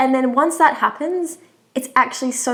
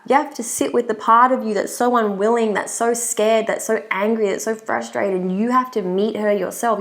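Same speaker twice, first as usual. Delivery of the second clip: normal talking voice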